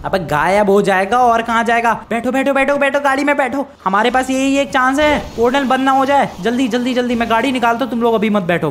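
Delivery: high, pressing commands; panicked urgency. Acted. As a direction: hurry everyone along before the chance is lost. Rushing to the car before closing.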